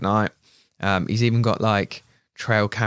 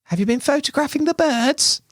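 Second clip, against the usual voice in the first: very high mock woman's voice; woman passer-by; 3 dB louder than usual. Woman with dogs questions two men in camo, high mock voice. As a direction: put on a high, singsong questioning voice.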